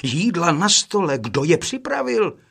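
excited brother asking who cooked; exclaiming in wonder. Acted. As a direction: marvel out loud at the food. Brothers find the laid table.

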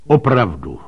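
lightly acted astonishment; startled exclamation. A narrator's reading. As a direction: exclaim it as if just seeing proof.

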